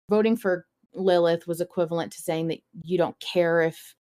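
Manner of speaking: ordinary talk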